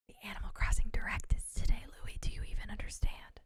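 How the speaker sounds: breathy whisper of excitement to her son. Real, not acted. Mother whispers to her son about the Direct, hushed. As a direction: hush it like a secret.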